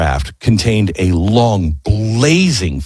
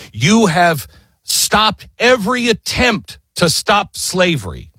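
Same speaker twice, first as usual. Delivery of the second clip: high, slow, emphatic charge; passionate accusation; 6 semitones higher than usual. Retold or imitated. Jefferson accuses King George over slavery, passionate and high. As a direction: accuse with measured force, pitch raised. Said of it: "he was so passionate about this".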